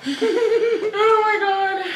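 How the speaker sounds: high squeal of laughter; laughing. Real, not acted. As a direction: laugh through the words. Laughing at friend's joke.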